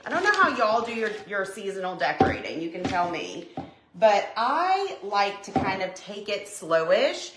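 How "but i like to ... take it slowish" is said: ordinary delivery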